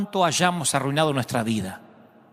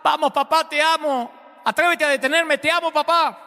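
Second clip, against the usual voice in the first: circle him and taunt him with love, pitched way up; provoking taunt; 11 semitones higher than usual. A narrator's reading. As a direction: son taunting his father with love, high and teasing. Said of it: "lo provoca".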